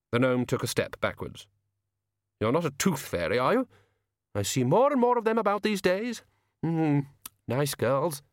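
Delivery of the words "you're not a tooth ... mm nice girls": high-pitched, inquisitive frost spirit; high artist's voice, idly interested; Jack Frost, curious. A narrator's reading. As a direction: lift the voice high, musing. Jack Frost questions the gnome.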